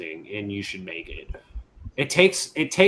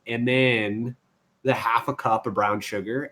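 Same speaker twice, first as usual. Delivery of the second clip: ordinary talk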